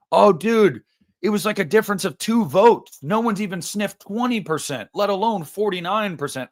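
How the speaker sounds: whiny imitation of a friend's pep talk; mocking consolation.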